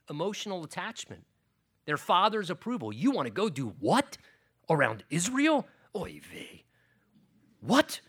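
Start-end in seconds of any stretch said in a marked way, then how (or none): 2.9-8.0 put-on father scolding in a Yiddish accent. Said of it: "I'm not sound like Italian, I guess, but I'm Italian".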